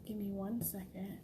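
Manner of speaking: hushed whisper, hiding away; whispering while hiding. Real, not acted. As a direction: whisper so nobody hears. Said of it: "Yes, I'm hiding in the bathroom".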